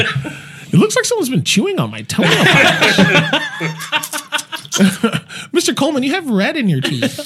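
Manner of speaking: high put-on customer voice. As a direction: pitch it up like a fussy customer. Customer speaking to Coleman.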